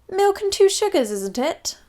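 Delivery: high, sly lightly voiced Queen; a hint of innocent royal mischief. Queen to the drenched Prime Minister. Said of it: "said the Queen".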